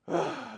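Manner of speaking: exhaling sigh after a rant. Real, not acted. Streamer recovering from his outburst, a sigh. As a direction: let out a breath to reset.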